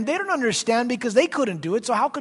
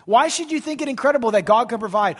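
normal talking voice

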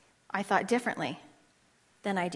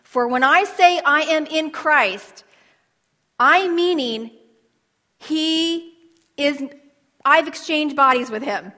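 preaching climax; 4 semitones higher, 5 dB louder than usual. Acted.

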